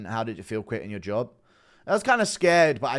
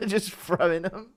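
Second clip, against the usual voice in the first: laughing, slow and high; hysterical laugh; 9 semitones higher than usual. Real, not acted. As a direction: giggle through the words. Laughing while recalling throwing things.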